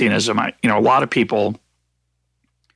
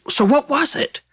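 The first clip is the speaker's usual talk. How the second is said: mock questioner. Everybody asks the naive question.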